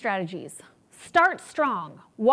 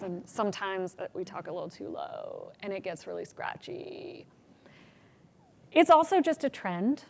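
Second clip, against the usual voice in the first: normal talking voice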